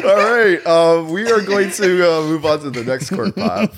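trying to stay on track while laughing. Real, not acted. Host laughing, trying to move the show on.

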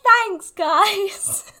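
high, loud, drawn-out mock thank-you; Am impression. Guest mimics how her friend answers compliments, high and hammed-up. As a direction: exaggerate a friend's way of taking compliments. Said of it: "the exact same way that Am always responds".

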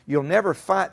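ordinary delivery

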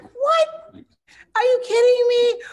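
high, loud disbelieving yell; incredulous shriek. Retold or imitated. Wife mock-yelling at her husband in disbelief. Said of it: "I'm like".

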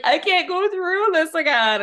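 high, loud mocking whine of the ex; whining it out high and loud like a crybaby; mock whiny plea. Jax begging Stassi in the car, mock whine. Jax pleading, voiced in recap.

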